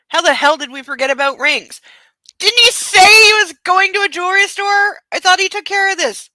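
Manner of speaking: high, loud alarmed outburst; panicked. Acted. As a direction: freak out about the forgotten rings. Panicked realising forgot rings.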